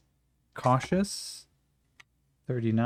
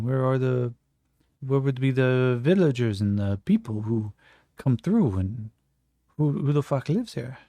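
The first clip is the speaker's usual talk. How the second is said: put-on a light French accent, wondering aloud; lightly voiced Frenchman, puzzled then blunt. Jean-Francois puzzles over the town in a light French accent. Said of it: "Jean-Francois goes".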